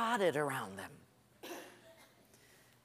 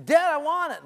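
put-on higher, louder childish whine; whining child; 9 semitones higher, 7 dB louder than usual. Child demanding a thing from dad, whining. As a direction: nag like a demanding kid.

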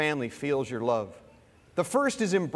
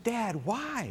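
higher, plaintive retold question; puzzled small boy; 6 semitones higher, 4 dB quieter than usual. Small boy asking his father about gifts, puzzled. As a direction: lightly voice a child questioning, pitch lifted.

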